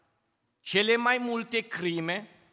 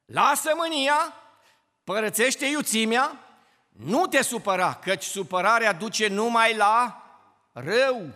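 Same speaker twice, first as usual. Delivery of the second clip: raised, emphatic recitation; preaching scripture; 2 semitones higher, 4 dB louder than usual. Acted. Preacher urging the congregation, emphatic recitation. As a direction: call the verse out to the church.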